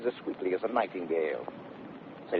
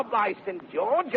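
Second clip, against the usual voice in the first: ordinary delivery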